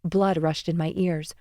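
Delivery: ordinary delivery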